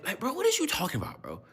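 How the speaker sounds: high, exasperated bro voice; mock baffled. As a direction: play up total confusion. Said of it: "I was like".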